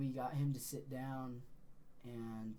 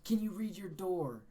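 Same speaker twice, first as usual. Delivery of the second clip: raised, high-pitched retold orders; exasperated yelling; 6 semitones higher, 4 dB louder, slower than usual. Telling a panicking friend to read his door, exasperated yelling. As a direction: imitate yelling at a friend to get a grip.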